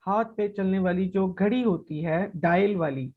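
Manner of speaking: ordinary talk, nothing marked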